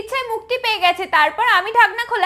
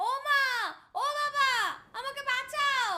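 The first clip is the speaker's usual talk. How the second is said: small, faint, faraway voice; distant cry for help; 11 dB quieter, slower than usual. Acted. Trapped daughter calls parents for rescue, distant and faint. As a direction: call out softly as if from far off. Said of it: "মনে হচ্ছে যেন অনেক দূরের আওয়াজ".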